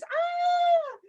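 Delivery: sudden high yelp; high cry. Acted. Librarian squealing while reaching for the stars. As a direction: squeal as you stretch up.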